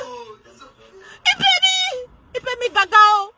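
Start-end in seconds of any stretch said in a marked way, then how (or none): 0.0-1.8 high, stretched-out words swallowed by laughing; shrieking it out through tears of laughter; helpless laughter. Real, not acted. Gloating over her enemy, shrieking with laughter.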